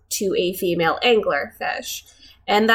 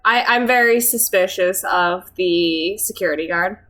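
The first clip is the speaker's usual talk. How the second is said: ordinary talk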